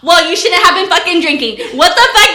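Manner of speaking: loud, high, exasperated telling-off; hammed-up outraged girlfriend; mock angry scolding. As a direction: reenact chewing him out at full volume. Acted scolding of jailed boyfriend.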